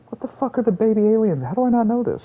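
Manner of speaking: high-pitched frustrated outburst; exasperated high yell. Real, not acted. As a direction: shriek in disbelief. Frustrated can't recall name.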